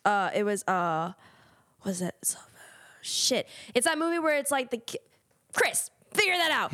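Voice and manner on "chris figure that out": exasperated plea. Real, not acted. Frustrated, asks producer for help.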